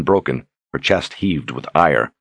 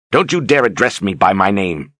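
furious; 4 semitones higher than usual. A narrator's reading. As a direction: snarl it at him in fury. Berdine strikes and rages.

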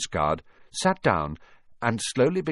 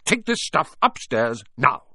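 higher, irritated command; snapping; 6 semitones higher, 4 dB louder than usual. A narrator's reading. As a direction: order him off, patience gone. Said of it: "snapped his uncle".

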